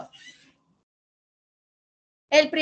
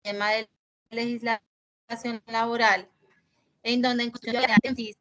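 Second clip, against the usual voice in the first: glitching audio. Real, not acted. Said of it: "Se está cortando la voz".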